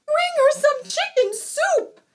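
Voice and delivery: very high, loud shout; frantic mother calling. A narrator's reading. Bride's mother orders help for her daughter, shouting high. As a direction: call it out high and urgent.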